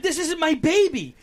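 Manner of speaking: put-on high-pitched dismay over a stranger baby; panicked. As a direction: plead in alarm. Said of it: "I'm like".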